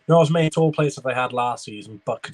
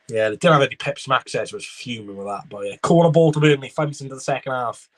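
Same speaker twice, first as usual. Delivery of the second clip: usual speaking voice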